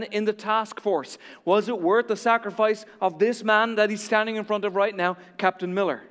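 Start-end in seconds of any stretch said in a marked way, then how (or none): none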